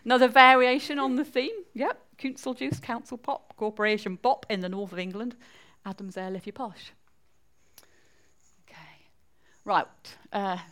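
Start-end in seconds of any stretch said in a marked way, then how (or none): none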